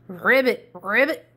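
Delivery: loud croaky ribbit; frog ribbit. Acted. Prince hopping like a frog.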